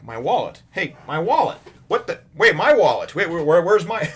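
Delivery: high, loud outraged shouts; indignant outrage. Retold or imitated. Villagers discovering Nami stole their wallets, high outraged shouts.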